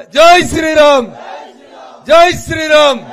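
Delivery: loud ringing rally cry. Real, not acted. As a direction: shout it out so the crowd joins in. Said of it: "మరి గట్టిగా చెప్పాలి కదా".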